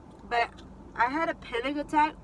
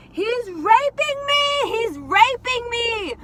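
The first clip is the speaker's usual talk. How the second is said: high, loud, drawn-out cry of alarm; retold yelling; 11 semitones higher, 7 dB louder, much slower than usual. Teller running from the car to alert others, acted yelling. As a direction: stretch each word in an acted yell for rescue. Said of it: "I started yelling".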